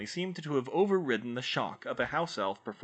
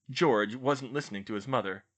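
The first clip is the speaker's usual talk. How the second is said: ordinary delivery, nothing marked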